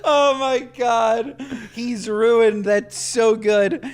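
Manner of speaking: loud delighted laughter, words stretched slow; laughing hard. Real, not acted. Host reacting to the Casper story, laughing. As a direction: gasp it out through laughter.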